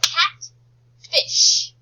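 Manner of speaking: CATFISH split wide, CAT... FISH, high and loud; slow, loud two-part CATFISH; syllable by syllable. Shown as a demonstration. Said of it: "what's the first syllable".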